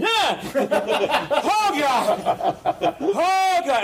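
high startled yelps of a jumpy professor; scared shriek. Retold or imitated. Professor spots the skeleton mid-lecture, high startled shriek. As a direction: shriek and gasp as if the skeleton moved.

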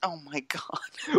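whispered disbelief. Real, not acted. Cohost whispers in disbelief at the bit. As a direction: breathe it out in disbelief.

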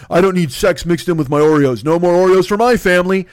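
mock the outraged conservative mom; mock offended mom. Imitating the conservative writer refusing Oreos, prim mock outrage. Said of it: "this woman's like".

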